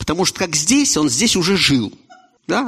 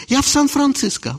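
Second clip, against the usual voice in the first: high emigrant voice; lightly voiced emigrant; higher voice; 10 semitones higher than usual. Emigrant replies.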